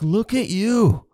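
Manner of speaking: high, loud, slow scolding; mock-exasperated lecture to yourself; self-scolding outburst. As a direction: let it burst out at yourself. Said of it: "I'm like".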